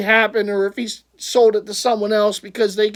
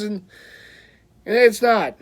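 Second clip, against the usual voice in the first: ordinary talk, nothing marked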